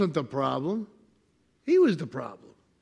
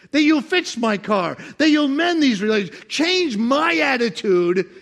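higher, louder pleading prayer; loud voiced prayer; 8 semitones higher, 8 dB louder than usual. Preacher voicing a believer's prayer, loud plea. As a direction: pray it out, high and urgent.

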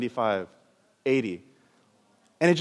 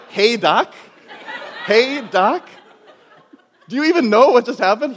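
higher and louder, laughing disbelief; incredulous echo; 8 semitones higher, 6 dB louder than usual. Retold or imitated. The doctor echoes the healed patient, laughing and incredulous. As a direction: repeat his words back in amazement. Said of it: "I was like".

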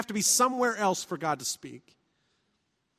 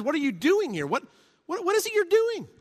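incredulous question; 8 semitones higher than usual. Shown as a demonstration. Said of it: "But another is".

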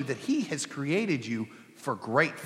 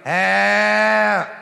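loud, higher demanding "ah"; boy demanding more chicken; toddler whining grunt; 5 semitones higher, 11 dB louder than usual. Retold or imitated. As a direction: fuss like a baby wanting more food. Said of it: "he'd run out of chicken and go".

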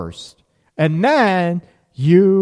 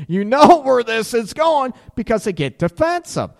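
ordinary talk

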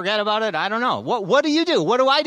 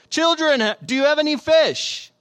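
high, raised calling voice; 7 semitones higher, 3 dB louder than usual. A narrator's reading. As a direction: call across the water from the shore. Jesus calls from the shore.

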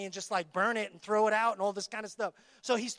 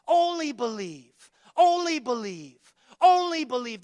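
Only believe, growing louder each time; building refrain; 7 dB louder than usual. Acted. Preacher rallying the congregation, swelling louder.

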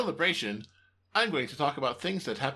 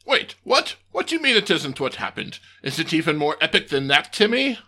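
surprised alien boss; 6 dB louder than usual. Acted. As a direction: jump up in surprise.

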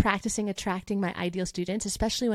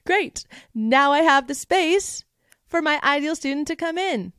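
delighted exclamation; 5 semitones higher, 4 dB louder than usual. Retold or imitated. Teacher imitating herself cheering a freed-up schedule, high and pleased. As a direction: gush as if a slot just opened up. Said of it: "I often will be like".

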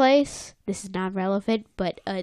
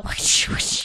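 voiceless mouth-made swishes; hushed "whsh" fight effects; imitated whoosh noises. Recapping the karate fight, imitated whooshes. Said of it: "I just love making that noise".

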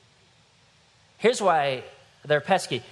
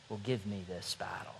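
a quiet certain promise to the giant; whispered vow; 5 semitones lower, 12 dB quieter than usual. Acted. David whispering his faith to the giant before battle. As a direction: whisper it, unvoiced, firm. Shepherd boy facing the giant.

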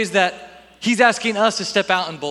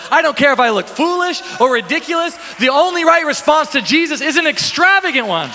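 rising passionate cry; 8 semitones higher, 4 dB louder than usual. Retold or imitated. Bartimaeus crying out in faith.

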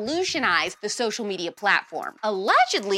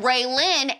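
usual speaking voice

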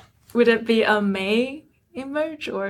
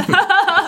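a quick, high, louder laugh; amused laugh; 9 semitones higher, 5 dB louder than usual. Real, not acted. Interviewer laughing as she waves off the forgotten title, amused laugh. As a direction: let a real laugh burst out.

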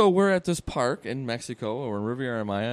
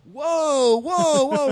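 much higher, louder whoa whoa; calling whoa; 10 semitones higher, 7 dB louder, much slower than usual. Retold or imitated. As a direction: call out to hold the bird back. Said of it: "and the guy's like".

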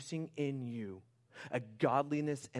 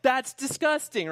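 mock disgust; 12 semitones higher, 11 dB louder than usual. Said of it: "You would be like".

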